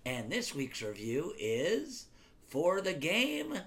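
loud start easing into a slow, drawn-out handover; announcer introduction. Shown as a demonstration. Dad handing over to Cole for his review, stretched announcer delivery. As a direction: stretch it out like a show host. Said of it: "I have to introduce it to you".

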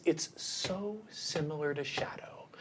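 hushed secretive whisper. Acted. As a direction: lean in and whisper it. Creature confides a secret.

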